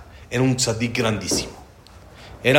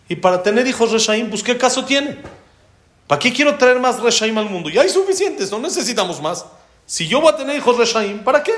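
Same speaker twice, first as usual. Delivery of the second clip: higher-pitched, worked-up arguing back; protesting; 8 semitones higher than usual. Retold or imitated. King justifying himself to the prophet, protesting. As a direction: argue it as rising rhetorical questions.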